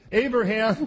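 loud, high call of the angel. Retold or imitated. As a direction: call his name from heaven. Angel calling from heaven.

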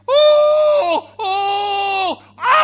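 long, very loud high wail; hammed-up bawling at full volume; mock wailing. Brother running to hug his brother, mock wailing. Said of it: "and he's crying".

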